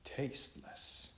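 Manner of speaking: ordinary delivery, nothing marked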